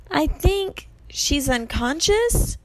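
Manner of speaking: high, slow hopeful call. A narrator's reading. Grover reports to friends below, calling out. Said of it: "Grover yelled somewhere above us".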